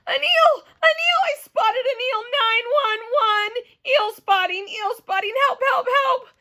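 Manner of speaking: high, loud panicky cries; panicked. Acted. Junie B yelling for help at a swimming eel. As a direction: shriek in full alarm.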